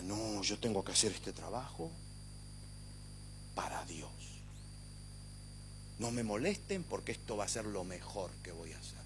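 soft, hushed voice of a devoted worker, dropping to a whisper; imagined worker. Pretend. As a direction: murmur it like a worker absorbed in his task.

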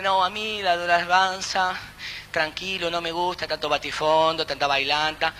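softly mock the prudish believer; prim legalist. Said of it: "Hay alguna gente que ... dice".

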